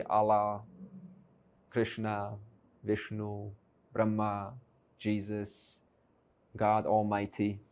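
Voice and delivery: level monotone, each name set apart; solemn naming. Acted. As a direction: intone each name like a rival answer. Dream characters each name their creator.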